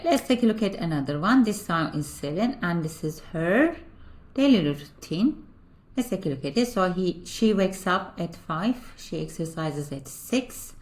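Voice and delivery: ordinary talk, nothing marked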